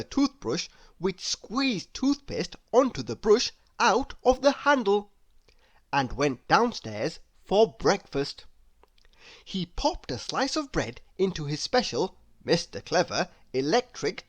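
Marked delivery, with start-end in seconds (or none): none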